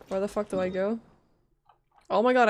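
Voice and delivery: normal talking voice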